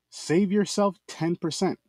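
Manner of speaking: slow, stretched sales line; slow ad repeat. Acted. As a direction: draw the offer out slowly.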